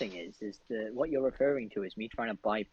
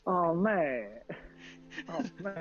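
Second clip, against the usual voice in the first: higher-pitched mock northern British; northern British accent; 6 semitones higher, slower than usual. Player mocking the guard greeting, northern British accent. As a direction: play up a northern British "mate". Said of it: "northern British thing".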